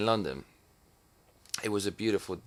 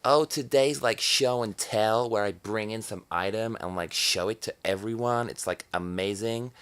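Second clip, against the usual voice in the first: mock American kid; 3 semitones higher than usual. Mocking American classmates in a chirpy American accent. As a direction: send up a Yankee kid gushing about show and tell. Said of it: "That's what my Yankee friends might say".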